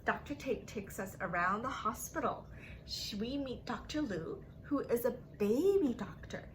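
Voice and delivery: ordinary delivery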